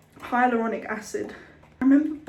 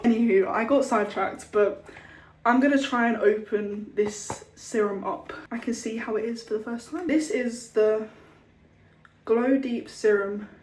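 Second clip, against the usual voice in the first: ordinary delivery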